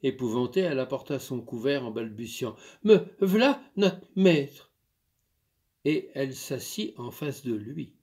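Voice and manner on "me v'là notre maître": a light touch of a country maid, called out; eager maid. Acted. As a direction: hurry to answer the master. Maid hurries back.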